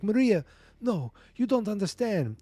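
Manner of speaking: high-pitched desperate father; acted pleading. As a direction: plead with an angry daughter, lightly dramatized. Father pleads with angry daughter.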